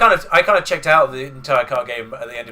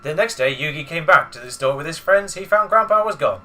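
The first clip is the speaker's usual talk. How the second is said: story narration; 3 semitones higher, 6 dB louder than usual. Acted.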